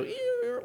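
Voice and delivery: high squeaky door creak. Retold or imitated. Preacher imitates the church side door creaking. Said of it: "I hear it go".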